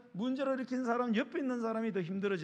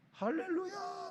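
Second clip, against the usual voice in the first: put-on child's gleeful yell; 12 semitones higher, 4 dB quieter, slower than usual. Preacher mimics a child running noisy in a café, high mock yell. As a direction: imitate a noisy kid crying hallelujah in a high, stretched voice. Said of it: "소리를 질러요".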